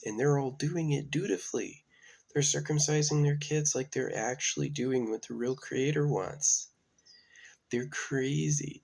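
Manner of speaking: high, loud outraged rant; rising indignant outrage. Real, not acted. Ranting about circumcision, voice rising.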